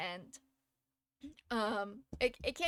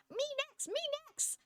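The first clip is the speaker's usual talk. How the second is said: put-on much higher, eager kid voice begging for a turn; eager little boy. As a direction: squeak it like an excited little boy. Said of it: "he's just like".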